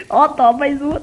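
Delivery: usual speaking voice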